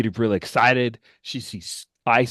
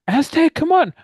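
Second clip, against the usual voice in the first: high, loud yell to a companion; urgent call; 6 dB louder than usual. Acted. Player voices fleeing character calling Aztec.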